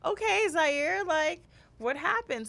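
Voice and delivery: gentle asking. Retold or imitated. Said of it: "So I'm like".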